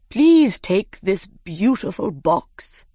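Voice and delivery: slow, grand Dodo voice; the Dodo presenting a gift, slow and formal. Acted. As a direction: offer it slowly and grandly, like the Dodo. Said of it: "he said".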